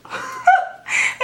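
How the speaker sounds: sudden high incredulous laugh; high squeal of a laugh. Real, not acted. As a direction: burst out laughing in disbelief. Laughing after refusing his hand.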